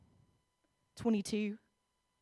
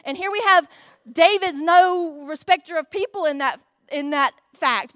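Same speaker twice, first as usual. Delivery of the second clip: normal talking voice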